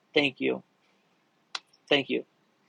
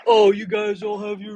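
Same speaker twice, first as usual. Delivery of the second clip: louder, higher put-on jeering voice; mocking mimicry; 5 semitones higher, 4 dB louder than usual. Streamer throwing a viewer's comment back, mocking. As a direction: parrot them mockingly, trailing into gibberish.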